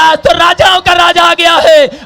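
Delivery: loud shouting. Acted. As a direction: cry it out to the whole crowd. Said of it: "और जोर जोर से चिल्लाने लगा". (ज़ोर से चिल्लाकर घोषणा)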